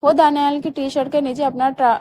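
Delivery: ordinary delivery, nothing marked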